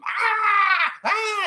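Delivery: put-on high piercing girl's scream; little girl screaming. A little girl in the alley screaming in terror. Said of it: "but screaming at him".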